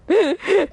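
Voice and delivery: sudden high squeal of laughter; high laugh burst. Real, not acted. Cackling at a friend's awful Arnie impression.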